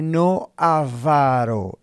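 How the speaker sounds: "avaro" very slow, stressed on the middle VA; slow teaching model of "avaro", accent on VA; careful demonstrated pronunciation. Shown as a demonstration. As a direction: draw out "avaro" as a-VA-ro, slowly and deliberately. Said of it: "observe cómo estoy pronunciando esa palabra".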